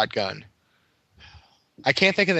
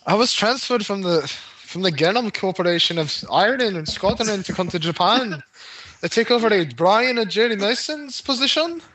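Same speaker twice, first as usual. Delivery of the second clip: high put-on Scottish accent, hammed up; a Scottish transfer introducing herself, badly faked; 7 semitones higher than usual. Fan doing Madigan's Scottish accent for friends, high hammed-up Scottish brogue. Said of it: "That's probably a terrible accent".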